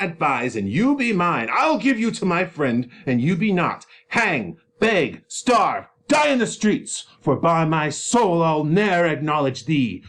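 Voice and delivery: loud, angry father tearing into his daughter; furious Capulet berating his disobedient daughter; raging father Capulet. Acted. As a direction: roar at her like an enraged father. Capulet's threats.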